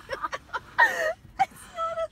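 shrieking burst of laughter. Real, not acted. Mother laughs hard.

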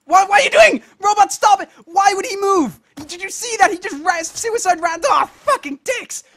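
very high, loud disbelief; high-pitched, frantic outrage; outraged yelling. Real, not acted. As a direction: shriek at a game that betrayed you. Character runs off the ledge.

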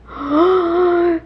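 sharp happy gasp at the clean shelf; delighted gasp. Real, not acted. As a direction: gasp in pleased surprise. Cabinet freshly cleaned, delighted.

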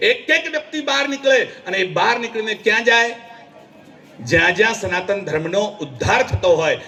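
ordinary talk, nothing marked

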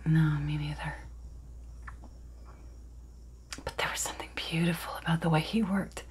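soft, half-whispered confession; hushed dramatic monologue. Acted. Miranda remembers the way he worked, hushed and confiding. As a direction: confide it softly, pausing between thoughts.

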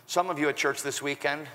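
ordinary talk, nothing marked

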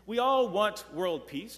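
ordinary talk, nothing marked

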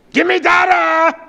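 high, acted demanding shout; demanding cry. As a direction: cry out like a boy demanding his father. Acts boys' demand for daddy.

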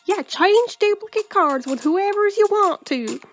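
put-on hammy drawling accent with swooping pitch. Game heroine showing her cards and diary, thick drawling accent. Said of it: "I should do it with an accent".

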